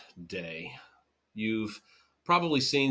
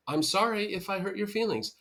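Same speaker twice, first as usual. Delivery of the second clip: higher, airy fake apology; mock non-apology; 9 semitones higher than usual. Imitating a partner's hollow apology, higher and airy. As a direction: toss off a hollow sorry.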